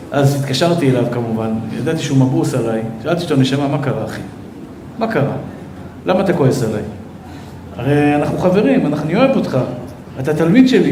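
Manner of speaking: low, soft, gentle plea; a soft low voice full of care; tender. Retold or imitated. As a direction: coax a hurt student softly. Rabbi recalls gently calling student.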